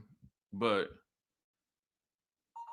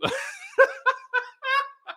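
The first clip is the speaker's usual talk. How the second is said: a real squeal of laughter; high-pitched giggle, caught off guard. Real, not acted. Laughs at the mother's reply.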